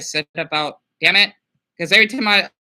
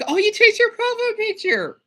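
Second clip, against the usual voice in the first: surprised; 6 dB louder than usual. Real, not acted. Noticing a new profile picture.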